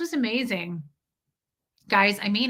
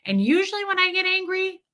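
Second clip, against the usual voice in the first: ordinary talk, nothing marked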